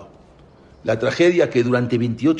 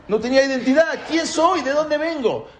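high, urgent rhetorical questions; impassioned questioning; 13 semitones higher than usual. Acted. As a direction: push the questions out high and insistent. Voicing David's former crisis of identity.

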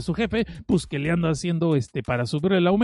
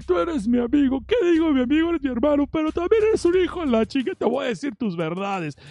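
put-on drunken reproach; 9 semitones higher than usual. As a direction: whine it like a drunk buddy airing grievances. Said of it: "la hora de los reclamos".

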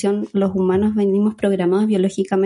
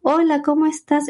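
put-on high, sing-song greeting to a baby; baby talk; 8 semitones higher than usual. Shown as a demonstration. Mother greeting her baby with questions, high sing-song voice. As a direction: coo it like a mother to her newborn. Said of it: "va a ser otro tipo de entonación".

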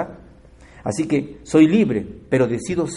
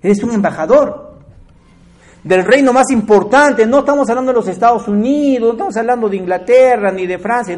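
fiery pulpit proclamation; preaching at full force; 7 semitones higher, 6 dB louder than usual. Acted. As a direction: proclaim it to the whole congregation. Sermon rises to climax.

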